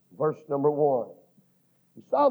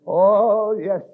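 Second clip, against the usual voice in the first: ordinary delivery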